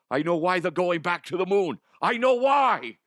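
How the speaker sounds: high, loud, excited comic character voice; passionate. Acted. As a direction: blurt out the big discovery, rising to a shout. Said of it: "you're so passionate".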